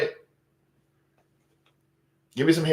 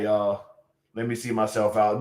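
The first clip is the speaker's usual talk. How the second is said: ordinary delivery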